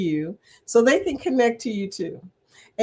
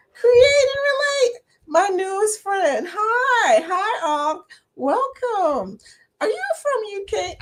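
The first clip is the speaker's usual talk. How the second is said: much higher, bubbly welcome; excited greeting; 11 semitones higher than usual. Real, not acted. Greeting her newest friend in chat, excited and high. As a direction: squeal hello to a new friend.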